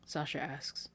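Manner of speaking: ordinary delivery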